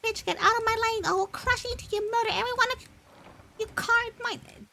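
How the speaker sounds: shrill high-pitched mock rage; screechy high yell. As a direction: screech it like a cartoon villain. Yelling at other players in character.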